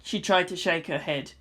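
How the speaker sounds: ordinary delivery, nothing marked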